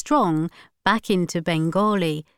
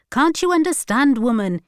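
high, raised angry voice; shouted; 6 semitones higher, 4 dB louder than usual. A narrator's reading. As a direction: snap at his wife over the TV. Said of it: "he shouted".

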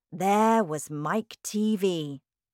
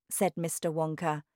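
usual speaking voice

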